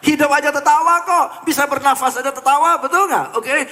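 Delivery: loud, high, excited push. Real, not acted. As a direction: fire the crowd up, loud and high. Audience just laughed together. (bersemangat, keras dan tinggi)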